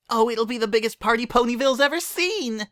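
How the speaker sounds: bouncy indignant squeal; high excited filly voice; Pinkie, indignant. A narrator's reading.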